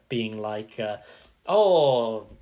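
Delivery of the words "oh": put-on jokey Russian accent, da da; hammed-up Russian fan accent; hammed-up Russian accent, slow on comrade; mock Russian accent. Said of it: "That's an impeccable Russian accent".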